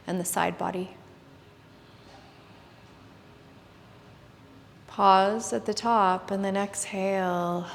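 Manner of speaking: hushed yoga class guidance; soft spa whisper. Acted. Leads a guided meditation.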